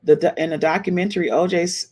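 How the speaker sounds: ordinary talk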